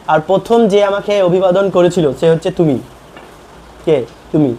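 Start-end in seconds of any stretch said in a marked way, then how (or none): none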